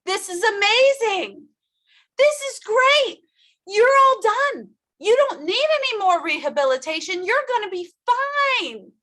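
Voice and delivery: overjoyed, as if it were great news; thrilled doctor. Retold or imitated. Doctor voiced announcing results.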